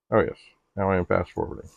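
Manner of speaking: usual speaking voice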